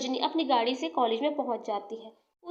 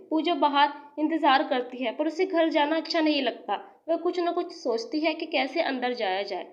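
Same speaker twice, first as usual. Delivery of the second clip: ordinary delivery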